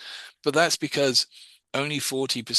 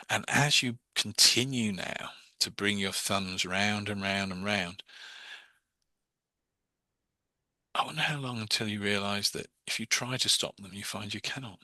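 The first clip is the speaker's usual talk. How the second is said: hypnotic induction; 4 dB quieter than usual. Shown as a demonstration.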